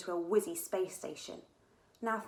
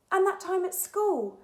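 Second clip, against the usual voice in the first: put-on girl voice; 12 semitones higher, 9 dB louder than usual. Katy joins in eagerly.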